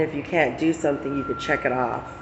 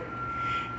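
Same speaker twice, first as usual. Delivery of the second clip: breathy laugh; 11 dB quieter than usual. Real, not acted. Laughing at own story.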